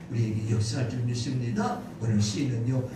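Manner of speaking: normal talking voice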